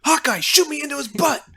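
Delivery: high, loud call to a teammate; acted hero's shout. Ant-Man calling to Hawkeye in battle, high eager shout. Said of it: "and Ant-Man goes".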